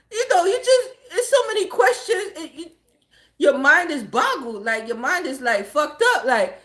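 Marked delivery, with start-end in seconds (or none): 0.1-2.7 high-pitched words through real laughter; giggly, breaking-up delivery; laughing. Real, not acted. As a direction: explain while cracking up. Laughing as they explain the shock.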